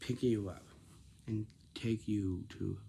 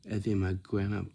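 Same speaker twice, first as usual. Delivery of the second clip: normal talking voice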